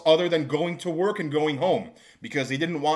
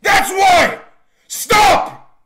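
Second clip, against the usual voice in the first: high, loud, slow yelling; furious shout; 11 dB louder, slower than usual. Retold or imitated. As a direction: blow up at them. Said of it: "quarrel with servers".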